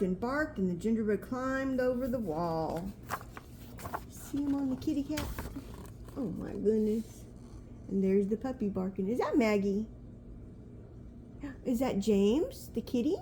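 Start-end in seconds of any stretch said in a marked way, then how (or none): none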